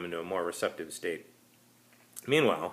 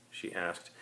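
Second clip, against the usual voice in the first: usual speaking voice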